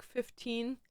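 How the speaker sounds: usual speaking voice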